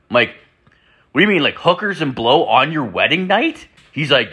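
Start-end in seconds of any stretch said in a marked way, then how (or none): 1.1-3.7 high, rising disbelief; acted-out incredulous friend; incredulous question. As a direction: question it as if you can't believe your ears. Incredulous reply to friend's confession.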